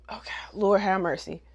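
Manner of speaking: hushed, weary aside; exasperated whisper. Real, not acted. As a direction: whisper a prayer for patience. Weary aside after ranting.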